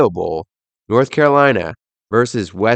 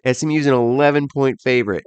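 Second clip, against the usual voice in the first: ordinary talk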